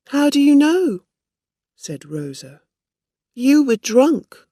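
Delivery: high, loud defensive retort; heated. A narrator's reading. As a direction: fire it back loudly. Rosa's heated denial.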